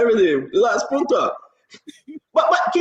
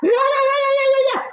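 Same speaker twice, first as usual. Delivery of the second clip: high mocking yeah yeah yeah; mock falsetto yeah; faster than usual. Host mocking Fina Bono's prophesying, in a high mock squeal.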